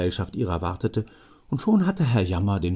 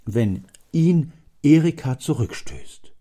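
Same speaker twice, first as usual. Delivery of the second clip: insistent father; 3 semitones higher, 6 dB louder than usual. Acted. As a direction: assure him eagerly.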